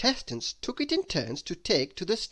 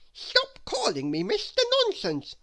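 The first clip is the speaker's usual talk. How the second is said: complaining; 3 dB louder than usual. Acted. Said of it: "complained Mister Nonsense".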